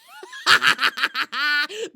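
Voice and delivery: high mocking cackle; gleeful squirrel cackle. Squirrel laughing at her rival. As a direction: cackle with glee over the hidden treats.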